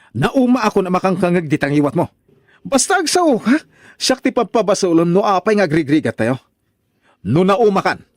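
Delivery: angry retort. Acted. Husband Victor snapping back at his complaining wife, angry. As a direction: throw her words back at her.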